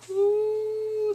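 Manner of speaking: high squealed ooh of shock; a high, loud, scandalised ooh; mock shocked ooh. Reacting to the husband fleeing.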